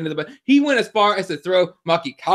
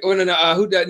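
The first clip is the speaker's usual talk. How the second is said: ordinary talk, nothing marked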